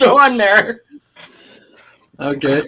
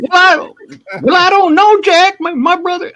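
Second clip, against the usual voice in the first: much higher and louder, fussy; squeaky high Furley voice; Mister Furley doing Don Knotts, high; Mister Furley impression; 12 semitones higher, 3 dB louder than usual. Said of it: "Remember Mister Furley".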